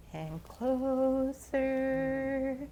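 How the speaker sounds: very slow, stretched "closer"; drawn-out suspense. A narrator's reading. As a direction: stretch each word as the snake inches in. Snake creeping closer.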